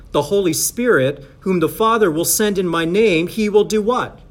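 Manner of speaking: rising preacher's call for an answer; emphatic preaching. Real, not acted. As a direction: build up to a question for the congregation. Preaches the verse, raised voice.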